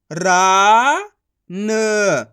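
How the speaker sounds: रा loud and long, then न apart; syllable by syllable. Shown as a demonstration. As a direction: sound out रा and न separately. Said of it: "एक अक्षर का उच्चारण करके".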